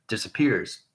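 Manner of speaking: usual speaking voice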